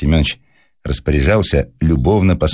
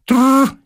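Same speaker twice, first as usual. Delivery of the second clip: imitated crackle. A narrator's reading. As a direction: imitate the cracking of the crates with a rolled r. Сound of cracking crates. (трескучее «трррр», выше и громче)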